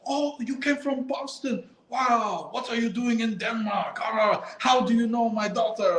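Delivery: high, sing-song parent voice; bright mock Danish parent; hammed-up curious parent. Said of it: "It's like".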